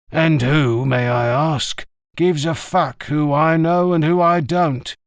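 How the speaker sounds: put-on an old shopkeeper who won't be pushed around; gruff old greengrocer. A narrator's reading. Greengrocer bristling at a stranger's question, slow and defiant.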